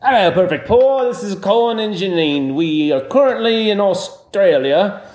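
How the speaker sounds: a hint of Australian accent, slowed down; bad Australian accent. Callers on a voicemail, a weak Australian accent. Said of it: "we have Australian accents".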